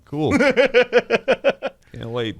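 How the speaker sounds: high, laughing mock enthusiasm; sarcastic. Real, not acted. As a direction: fake excitement with a laugh. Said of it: "sarcastic reply".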